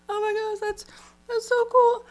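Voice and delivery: high, loud squeal of delight; gushing excitement. Retold or imitated. Gushing over a contestant on a food show, high excited squeal. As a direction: squeal it like a proud fan. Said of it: "And I was like".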